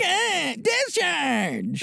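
high, soft mock voice; squeaky mock of a recorded voice; imitating own recorded voice. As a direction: mimic how you sound on a recording. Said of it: "how your voice sounds".

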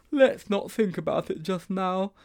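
put-on high, slow boyish voice; high-pitched schoolboy plea; uneasy Sponge. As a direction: put off the worry, slowly and up high.

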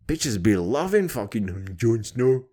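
ordinary talk

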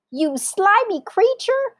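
high, loud, slow insult; outraged monkey cry. A narrator's reading. Monkey insulting child-eating crocodile, high outrage. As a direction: squeal it, stretching the words. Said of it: "cried Mugglewump".

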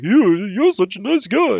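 high, slow dopey voice; dopey minion voice. Retold or imitated. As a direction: play a spaced-out henchman. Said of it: "the Bakuman's like".

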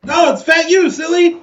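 loud, high, teasing shout; playful shout. Acted. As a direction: call it out to the crowd. Player shouts in character.

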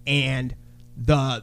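ordinary delivery

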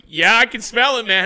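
loud, high acted enthusiasm; re-enacted eager agreement; excited reply. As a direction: call it out, hyped up.

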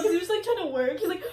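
ordinary delivery

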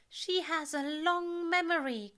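high, loud ominous warning. A narrator's reading. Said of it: "Aziraphale says ominously".

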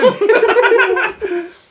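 shrieking burst of laughter; loud high laugh. Real, not acted. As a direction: howl with laughter. Laughs at game mixup.

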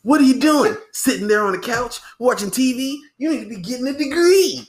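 loud, high mock commercial harangue; imitated ad pitchman. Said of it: "He was like".

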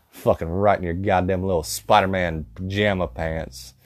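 usual speaking voice

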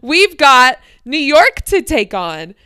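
loud, slow, high mock outrage; indignant refusal. Friends refusing an hour wait, loud and slow indignant. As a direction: drag it out, loudly refusing.

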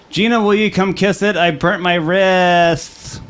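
high, slow put-on voice of a friend; a mocking impression of a pal, high and drawn out; imitating Ian. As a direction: mimic him whining for a kiss. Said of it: "That's how I think he talks".

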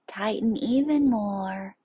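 "even" lifted high and loud; urging tension. Shown as a demonstration. Urging students to squeeze harder.

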